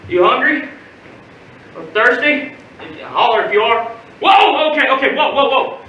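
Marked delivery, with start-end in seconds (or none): 4.2-5.8 higher, quick string of whoas; alarmed. Acted. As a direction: jump in to stop someone. Uncle stops boy leaning over bridge.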